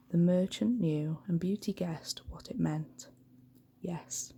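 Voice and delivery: usual speaking voice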